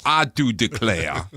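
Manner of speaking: slow, drawn-out Southern drawl; Southern accent. Shown as a demonstration. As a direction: put on a hammy Southern accent. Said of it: "do it in a Southern accent".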